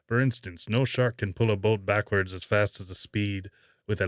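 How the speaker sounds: loud Robert Shaw as Quint impression. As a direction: play the crusty shark hunter answering an interview. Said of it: "I'll do it in my Robert Shaw voice".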